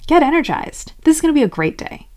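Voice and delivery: high, fast, pushy cheer; forceful pep talk. Acted. Forcing your tired self to perk up, pushy pep talk. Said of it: "Being forceful with it would sound like".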